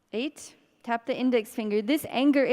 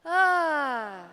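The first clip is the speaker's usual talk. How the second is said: released sigh-cry; 4 semitones higher than usual. Shown as a demonstration. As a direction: let a full voiced ah burst out. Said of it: "breathe and make some sound".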